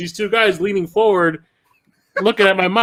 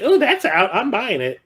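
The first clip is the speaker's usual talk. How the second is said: high, excited mom voice; imitating mom; 6 semitones higher than usual. Telling friends about mom at Walmart, high mock voice. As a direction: pitch it up like an eager shopper.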